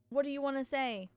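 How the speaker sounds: normal talking voice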